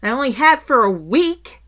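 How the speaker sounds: loud, high, pointed complaint; indignant, loudly stressed grievance, for effect; passive-aggressive. Retold or imitated. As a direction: make sure the guilty party overhears. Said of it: "I was being slightly passive-aggressive".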